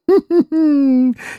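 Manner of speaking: high little giggle; a short sly snicker. Acted. Old Maksimov giggling after his joke. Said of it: "Хихикнул".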